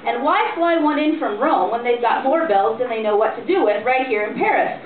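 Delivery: plain reading voice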